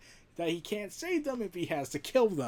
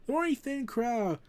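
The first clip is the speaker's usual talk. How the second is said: ordinary delivery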